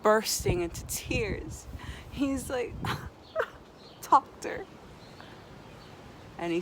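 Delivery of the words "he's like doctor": mock crying. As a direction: cry out Doctor in hammed-up tears. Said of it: "the guy starts crying".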